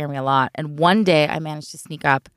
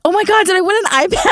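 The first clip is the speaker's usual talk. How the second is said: much higher and fast, thrilled; excited disbelief; 13 semitones higher, 4 dB louder, faster than usual. Retold or imitated. Finding an iPad in the cereal box, giddy and fast. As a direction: gush out in a rush of surprise.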